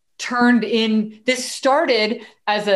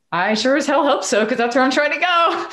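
emphatic retort; 6 semitones higher than usual. Retold or imitated. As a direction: toss it off like a joke to a stranger. Answering a stranger at bus stop.

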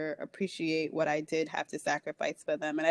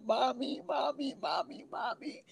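crying out for mommy; 6 semitones higher, 5 dB quieter than usual. Retold or imitated. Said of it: "just screaming out".

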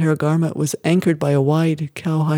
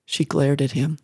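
ordinary delivery, nothing marked

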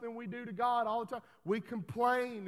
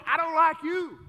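voicing God; 6 semitones higher, 12 dB louder than usual. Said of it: "God's sitting there looking at you going".

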